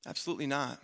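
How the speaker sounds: normal talking voice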